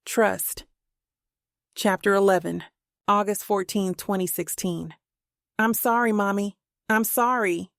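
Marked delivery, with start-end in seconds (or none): none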